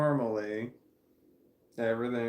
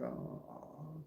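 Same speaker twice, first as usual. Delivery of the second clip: a breathy, much softer sympathetic aww; 4 semitones higher, 12 dB quieter than usual. Real, not acted. Feeling sick and sentimental, a soft aw. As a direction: let out a tiny pitying aw. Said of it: "so I'm like".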